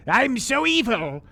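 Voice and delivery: over-the-top villain boast; evil voice. Pretend. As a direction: ham it up like a cartoon villain. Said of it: "Your evil voice".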